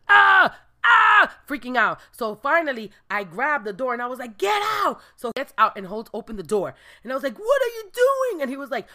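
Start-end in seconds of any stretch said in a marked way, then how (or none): 0.1-1.4 very loud, high screaming; panicked yelps. Retold or imitated. Senior freaking out at the rat, loud high screams. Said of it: "'cause he was like". 4.4-5.0 high sharp yell; shouted order. Retold or imitated. She yells at the senior to leave, high shout. 7.4-8.4 high, disbelieving yell; exasperated cry. Retold or imitated. She yells at the senior holding the door open, high disbelief.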